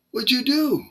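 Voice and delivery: high, loud alarmed cry in someone else's voice; alarmed Satanist. Retold or imitated. Satanist in the chat room reacting to the prayer, alarmed and high.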